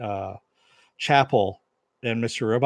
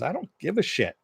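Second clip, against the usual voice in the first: high-pitched dismissive retort; retold dismissive reply; 8 semitones higher, faster than usual. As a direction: brush it off in a raised pitch. Retold defiant reply in a dispute.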